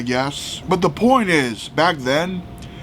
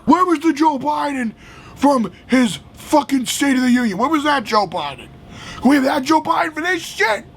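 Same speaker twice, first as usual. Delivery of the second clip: higher, shouted demand in real anger; heated shouting; 8 semitones higher than usual. Real, not acted. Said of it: "Sorry if I'm getting heated".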